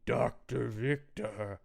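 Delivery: slow, halting monster voice. Retold or imitated. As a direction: drag the words out like a dazed creature. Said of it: "He's like".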